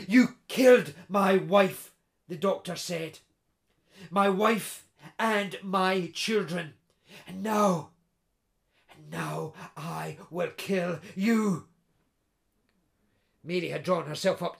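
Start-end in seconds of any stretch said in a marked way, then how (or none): 0.1-11.7 slow, grim, menacing vow; slow and cold, a deadly promise; cold deadly threat. Acted. Doctor accuses and threatens intruder.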